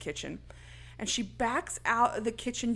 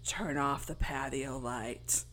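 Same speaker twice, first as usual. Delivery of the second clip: low, slow killer command; menacing caller voice; 8 semitones lower, 7 dB quieter, slower than usual. Acted. Killer ordering Casey over the phone, low slow menace.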